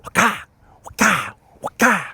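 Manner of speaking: loud high rhythmic waka waka; high chanted waka. Retold or imitated. Teacher slapping choking student's back, loud high chant. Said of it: "the whole hand thing".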